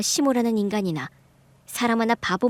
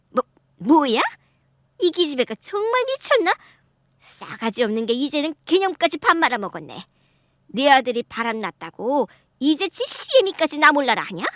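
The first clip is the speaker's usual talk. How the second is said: higher, louder sputtering mother-in-law; furious mother-in-law; 6 semitones higher, 3 dB louder than usual. Acted. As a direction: sputter with rage at a rude daughter-in-law.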